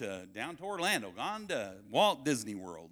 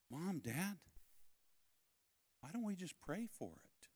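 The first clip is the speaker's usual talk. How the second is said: put-on soft, higher child's question; gentle, raised-pitch innocent suggestion; little boy's voice; 7 semitones higher, 11 dB quieter than usual. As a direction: imitate a small boy asking his parents. Voices his young son.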